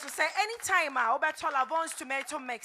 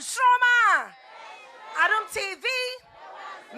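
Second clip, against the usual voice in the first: show host shout; 5 semitones higher, 5 dB louder, much slower than usual. Acted.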